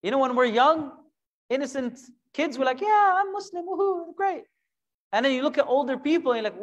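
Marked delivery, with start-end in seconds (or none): 2.8-4.4 put-on much higher, bouncy child cheer; naive kid voice. Preacher imitating innocent kids proud of faith, high childish cheer. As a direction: chirp it like a carefree kid.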